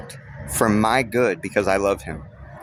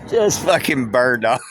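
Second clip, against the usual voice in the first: ordinary delivery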